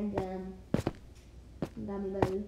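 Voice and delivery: ordinary talk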